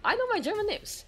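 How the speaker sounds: squeaky playful brag; mock proud boast. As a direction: boast it way up high. Playful boast, then whispered.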